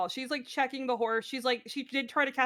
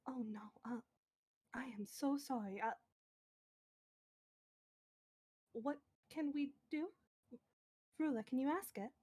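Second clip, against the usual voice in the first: very soft, halting apology; hushed concern; 15 dB quieter than usual. Acted. Nat to the suffering horse, hushed concern. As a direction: speak barely above a murmur, with gaps.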